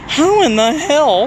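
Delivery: drawn-out stunned question. Retold or imitated. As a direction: let the disbelief hang. Retold disbelief, slow and loud.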